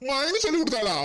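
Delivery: laughs through a scoffing question; mocking laugh. Brother laughs at his sister's blame, scoffing.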